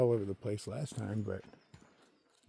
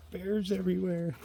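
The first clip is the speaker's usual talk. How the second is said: high, put-on scaredy-cat voice; mock the nervous hikers with exaggerated high alarm; mock fear; 11 semitones higher, 3 dB louder than usual. Mocks scared hikers' fear of bears.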